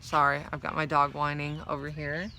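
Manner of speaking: usual speaking voice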